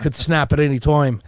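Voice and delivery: put-on loud Aussie accent; punchy Australian wildlife-show voice; mock Australian. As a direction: warn the crowd in a hammy Australian accent. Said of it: "to have an Australian accent".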